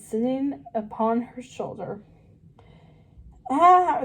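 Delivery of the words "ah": a high, loud startled scream. Acted. Shrill high yelp of fright. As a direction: Meg shrieks at the rat on her shoulder. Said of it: "screamed Meg Rousseau".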